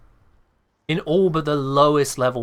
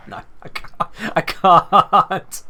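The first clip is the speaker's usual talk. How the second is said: cracking up mid-bit, barely getting words out; real laughter breaking through; 6 semitones higher, much slower than usual. Real, not acted. Streamer can't hold the chav bit together, laughing. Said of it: "'Cause I can't hold it together".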